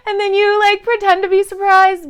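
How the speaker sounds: a high, loud put-on gasp of surprise; teasing imitation of a fake surprised guest; mock surprise. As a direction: play the fake surprise big. Mocking fake surprise at party.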